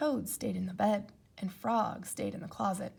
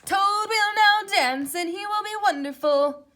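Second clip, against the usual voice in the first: announcer voice; 10 semitones higher, 10 dB louder than usual. Acted. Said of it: "said the voice".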